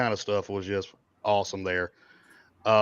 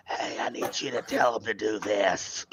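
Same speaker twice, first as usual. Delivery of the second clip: slow, softer, voice almost gone; lost voice; 4 dB quieter than usual. Retold or imitated. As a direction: croak it out with no voice left. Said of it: "I actually lost my voice".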